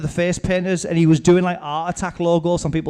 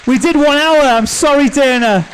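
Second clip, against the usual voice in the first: excited sign-off; 8 semitones higher, 5 dB louder than usual. Real, not acted.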